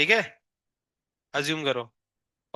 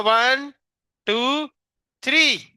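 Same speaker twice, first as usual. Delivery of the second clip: 1 rising count; 7 semitones higher, 4 dB louder, much slower than usual. Real, not acted. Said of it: "वापस से बोल रहा है".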